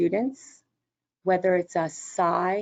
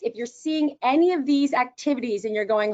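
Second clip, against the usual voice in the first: ordinary delivery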